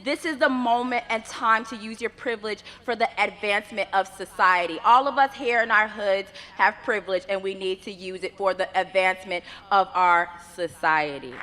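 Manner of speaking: ordinary delivery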